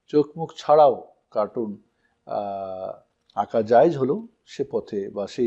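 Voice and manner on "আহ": ordinary delivery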